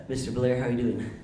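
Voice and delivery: impression of a folksy American president; George Bush. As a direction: greet him in a Bush impression. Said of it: "George Bush calls him up".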